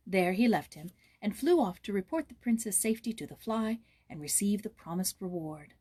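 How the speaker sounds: plain reading voice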